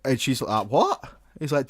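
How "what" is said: baffled. Acted. Baffled pizza worker answers prank call.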